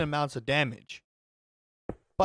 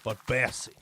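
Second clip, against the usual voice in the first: put-on southern accent; 6 semitones lower than usual. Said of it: "I'm using my southern accent".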